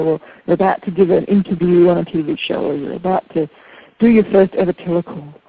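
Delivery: normal talking voice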